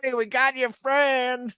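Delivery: high, loud, slow mock threat; menacing hillbilly shout. Hillbillies as the scared college kids hear them, menacing drawn-out mock holler. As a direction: holler it like a horror-film hillbilly. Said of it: "But to them sounds like".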